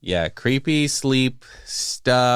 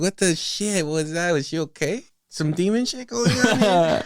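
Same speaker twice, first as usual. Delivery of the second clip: high, amused disbelief; bewildered wondering; 9 semitones higher than usual. Retold or imitated. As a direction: wonder aloud, freaked but laughing. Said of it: "And I'm like".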